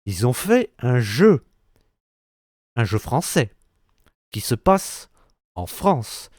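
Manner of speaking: short phrases split by long, pointed pauses; dramatic retelling. Acted. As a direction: build it up piece by piece, pausing before each detail. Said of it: "Attends, je, je te la refais".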